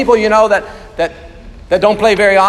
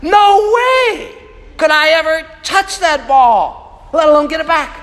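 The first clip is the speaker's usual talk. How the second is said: higher, amazed inner voice; awestruck disbelief; 6 semitones higher than usual. Retold or imitated. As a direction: gasp at a serve you can't touch. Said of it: "and I'm like".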